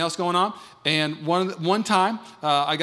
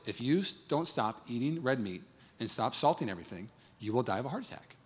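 grave warning; 5 semitones lower, 10 dB quieter than usual. Retold or imitated. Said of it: "the conversation would have been like this".

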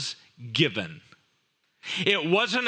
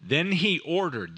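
normal talking voice

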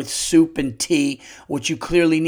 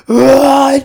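huge high imitated sneeze "Ah", much louder; sneeze impression; 9 semitones higher, 13 dB louder than usual. Mimicking the old man's over-the-top sneeze, loud. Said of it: "I couldn't even do an impression of".

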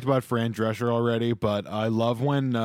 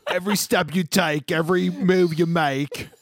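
high, loud drifting Aussie; an accent sliding into Australian; mock Australian accent; 7 semitones higher, 3 dB louder than usual. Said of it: "I've just descended into Australia".

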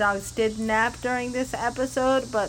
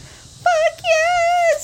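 high, loud triumphant whoop; excited cheer; 4 dB louder than usual. Real, not acted. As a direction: cheer like you just won. Cheering a podcast success.

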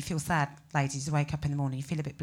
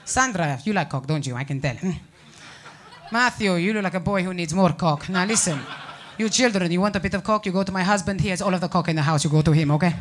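put-on a light Spanish-accented mum, loud and bossy to the kids; mock Spanish mum; 8 dB louder than usual. Mum offering coke to seven-year-olds, Spanish-accented and loud. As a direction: hostess pushing snacks in a Spanish accent. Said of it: "your mum spends the afternoon offering them cock".

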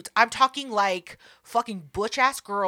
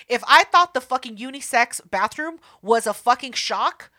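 ordinary talk, nothing marked

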